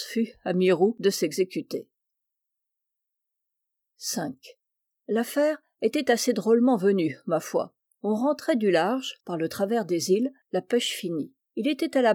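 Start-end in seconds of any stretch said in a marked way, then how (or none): none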